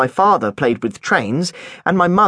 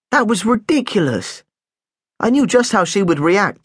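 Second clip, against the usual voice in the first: plain reading voice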